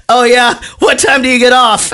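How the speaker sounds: mock drunken charm; gleeful quote. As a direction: toss it off like a tipsy playboy. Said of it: "And he goes".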